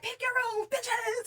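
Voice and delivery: high, soft mock host voice; sassy reality-show host; Bad Girls Club host. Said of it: "You know when they used to be like".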